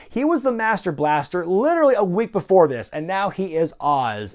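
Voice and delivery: ordinary talk, nothing marked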